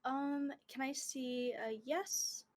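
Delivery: soft and slow, asking the pendulum; slow, hushed question. Real, not acted.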